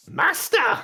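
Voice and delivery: high, loud pit droid cry; a droid calling out in a high pitch. Acted. Droid hails its new master, high droid voice. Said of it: "best describe as a pit droid".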